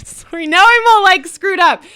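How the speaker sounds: laughing. Real, not acted. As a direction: giggle it out at your own mistake. GM laughs at own slip-up.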